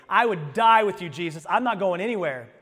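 loud, bold mock boast. Peter boasting to everybody in the upper room, loud bravado. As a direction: proclaim it loudly, full of bravado. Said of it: "Peter was boasting to everybody".

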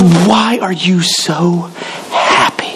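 very slow, trailing to a breathy "happy"; incredulous coworker. Retold or imitated. Coworker at the copy machine, slow trailing disbelief. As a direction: drag out "why" and let "happy" fade. Repeat, even slower, breathy end.

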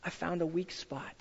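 soft and gentle, hushed down; gentle shepherd voice, quiet and tender; gentle God voice. Pretend. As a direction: tell him kindly, almost a whisper. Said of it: "he goes".

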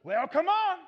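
high, loud beckoning call; calling back. Acted. Jesus inviting Peter onto the water, calling back. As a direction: call it out with a rising swell.

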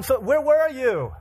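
high, drawn-out demanding cry; loud reproach. Acted. Mourners to Jesus who came late, loud reproach. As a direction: demand it loudly, stretching the words.

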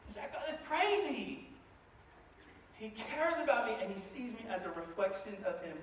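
usual speaking voice

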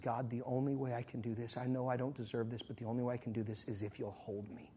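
low, very soft plea; hushed prayer. Retold or imitated. Widow praying at her door, hushed and subdued.

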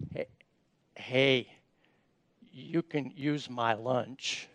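low, soft and slow little offer; gentle, hesitant child's voice; shy kid offer. Acted. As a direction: timidly hold out your lunch. Little kid offers his lunch.

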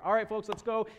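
high, loud call to the group; imitated boarding call. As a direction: mimic a ride attendant rallying riders. Cast member calling riders aboard.

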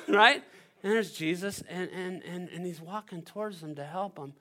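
usual speaking voice

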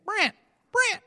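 very high, drawn-out cry of the name; high-pitched caricature of an aunt; mock aunt calling out. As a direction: call it in a put-on, high aunt voice. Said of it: "She doesn't really talk like that".

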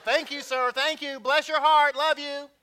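high, loud, gushing Texan thanks; thick Texas accent. Retold or imitated. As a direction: lay on the Texas sweetness, loudly. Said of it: "birth-given Texas accent".

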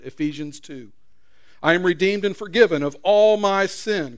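loud, building proclamation; preaching at full force. Real, not acted. Preacher declaring to the congregation who they are, loud. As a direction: preach it with rising fire.

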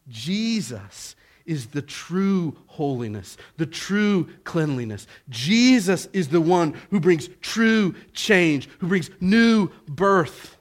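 building from measured to loud, ringing proclamation; emphatic pulpit crescendo; forceful preaching. Real, not acted. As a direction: preach it with rising force, punching key words.

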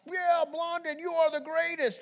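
high-pitched imitation of adoring fans; crowd going nuts. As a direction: imitate a fan hollering praise in a thin high voice. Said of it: "people are just going nuts".

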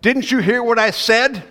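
high, loud, fed-up demand; exasperated. Retold or imitated. Disciples snap at the teacher, high and loud. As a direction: jab back with annoyance. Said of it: "It's sort of like".

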